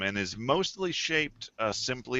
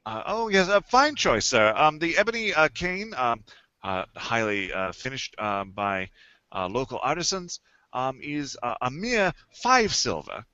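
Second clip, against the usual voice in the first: snooty waiter; 4 semitones higher, 3 dB louder than usual. Acted. GM voices shopkeeper selling canes.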